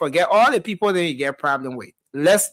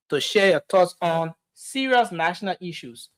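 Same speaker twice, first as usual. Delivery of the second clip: ordinary talk, nothing marked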